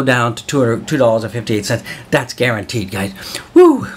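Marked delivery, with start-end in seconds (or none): 3.5-3.9 high, loud woo of hype; loud excited whoop. Real, not acted. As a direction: let out a big cheer. Cheering to close the section.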